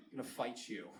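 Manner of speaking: low, soft menace; quietly threaten, half under your breath; deadpan low threat; mock threat. Mock threat to whoever asks.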